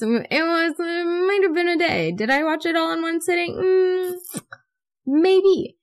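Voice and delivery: high, drawn-out coy confession; sheepish admission. Pretend. Vlogger owning up to binge-watching, sheepish. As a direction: sheepishly squeak out a guilty admission. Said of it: "It's embarrassing for me".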